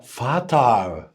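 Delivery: فطر stretched very slowly, every sound clear; slow model pronunciation. Shown as a demonstration. Teacher saying the German word for the child to repeat, slow. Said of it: "قول معايا".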